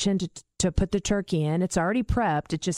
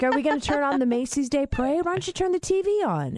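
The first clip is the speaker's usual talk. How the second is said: high bright coaxing; 7 semitones higher, faster than usual. Retold or imitated. Nudging dad brightly.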